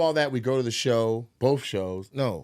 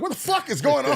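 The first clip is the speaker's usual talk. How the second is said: high, panicked what-the-fuck; startled cry, pitched way up; alarmed shout; 13 semitones higher than usual. Retold or imitated. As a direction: blurt it out in alarm from the stairs. Panicked rushing downstairs.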